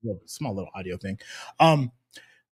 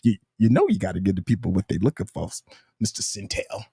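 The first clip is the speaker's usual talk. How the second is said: Rakai; 10 semitones lower, 4 dB quieter than usual. Retold or imitated. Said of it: "Rakai said".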